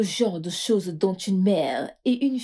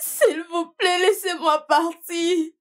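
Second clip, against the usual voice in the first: high, sobbing plea, slowed; tearful begging; 11 semitones higher, 4 dB louder, slower than usual. Acted. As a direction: beg through tears. Captive begs her captors.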